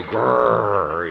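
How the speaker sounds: loud imitated dog growl. Dog sensing the visitor, a loud mock growl. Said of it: "Dogs go".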